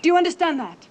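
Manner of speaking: high, loud scolding question; sharp motherly check; stern demand. Acted. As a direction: demand that the child answer. Mother demands child's obedience.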